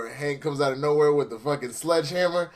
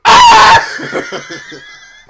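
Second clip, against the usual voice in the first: high screaming laughter; loud shrieking laugh; 14 dB louder than usual. Real, not acted.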